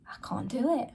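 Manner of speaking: soft unvoiced whisper to herself; whispered thought. Recalling her panic when he wouldn't answer, whispered. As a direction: breathe it out, no voice.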